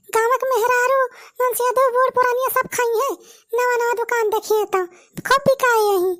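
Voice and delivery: village woman voice. Acted. Women characters chatting.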